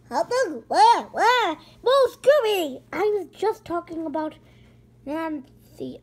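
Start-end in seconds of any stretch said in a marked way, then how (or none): 0.1-2.8 louder eager calling out; hot dog, where, where, shouted eagerly; excited. Pretend.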